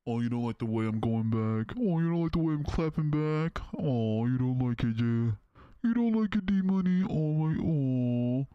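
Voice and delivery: loud, high singsong taunt; gleeful mocking jeer. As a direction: rub it in, gloating loud. Taunting a chatter, clapping back.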